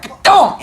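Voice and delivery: high loud imitated clonk of heads; coconut clonk. Teacher imitates two kids' heads colliding, loud high clonk. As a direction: make the sound of two coconuts hitting. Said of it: "it was like".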